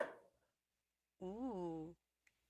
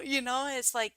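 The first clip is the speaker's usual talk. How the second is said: usual speaking voice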